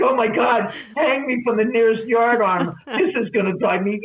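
high, loud comic despair; imitated dismay. Admirer hears her real voice, mock horrified outburst. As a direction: play the appalled suitor.

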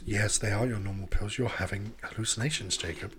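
low, soft, eerie machine voice; a calm sinister home assistant; creepy AI voice. Acted. Creepy AI voice again.

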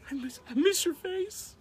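playful high-pitched voice; high sing-song. Acted. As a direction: coo it up high, teasing.

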